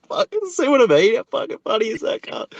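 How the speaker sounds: high giddy retelling; gleeful mate. Retold or imitated. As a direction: burst out laughing to your mates. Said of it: "you're like".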